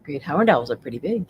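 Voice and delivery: ordinary delivery, nothing marked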